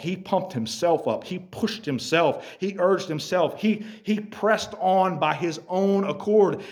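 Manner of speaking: ordinary talk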